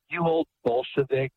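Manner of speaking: high, mocking scold of an old Bolshevik; a lightly voiced contemptuous rebuke. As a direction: sneer it like a scornful priest. Priest's imagined scolding, retold.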